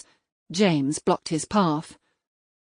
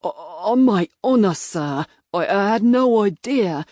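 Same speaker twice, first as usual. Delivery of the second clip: louder, stammering protest; stuttering; 5 dB louder than usual. A narrator's reading. Innkeeper to the magistrate, stammering his innocence. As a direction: stumble over the words, caught out. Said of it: "Mister Petheridge stuttered in reply".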